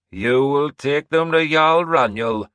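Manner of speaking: loud, slow commanding crone; old seeress ordering. Acted. Seeress ordering Orvar forward, loud and slow. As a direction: summon him to the throne. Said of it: "Breda ordered".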